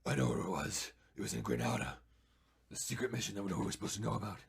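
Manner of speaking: low-pitched imitation of a haunted veteran; shell-shocked war veteran. Imitating veteran puppeteer recalling a secret mission, low haunted voice. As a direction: drop low and recount it like a traumatized soldier. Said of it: "playing this shell-shocked, uh, war veteran".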